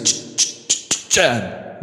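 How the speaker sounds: stammered, repeated "ch" before the name lands; put-on stutter. Host introducing co-host Chad, mock stutter. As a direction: get stuck on the first sound.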